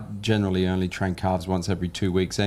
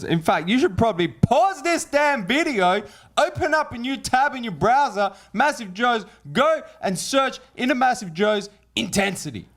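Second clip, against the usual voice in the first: hyped ad pitch; 12 semitones higher than usual. Pretend. Pushing the pre-workout to viewers.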